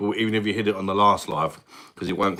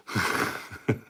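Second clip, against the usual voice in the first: brief voiceless laugh; short real chuckle; 4 dB quieter than usual. Real, not acted. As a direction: chuckle at your own joke. Laughs at own joke.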